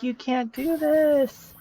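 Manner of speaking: high, drawn-out wail; hammed-up plea ending in a long held "no"; mock protest. Cormac facing memory erasure, mock wailing protest.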